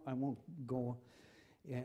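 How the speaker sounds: ordinary talk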